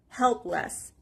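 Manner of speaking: HELP stressed in "helpless", second syllable light; stress on first syllable. Shown as a demonstration. Teacher showing learners the word's stress, first syllable stressed. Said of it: "The stress is on the first part".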